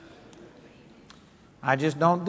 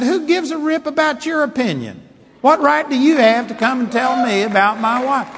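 retold angry retort; 7 semitones higher, 5 dB louder than usual. Retold confrontation with critic.

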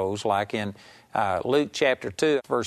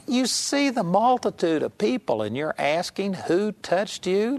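a mock scolding, pitched well higher, slowing on the last question; 7 semitones higher than usual. Disciples scolding Jesus for asking who touched him, mock scolding. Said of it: "I can imagine this being a scolding type of thing".

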